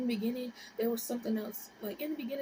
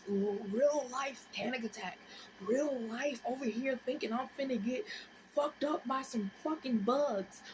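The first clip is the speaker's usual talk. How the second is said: usual speaking voice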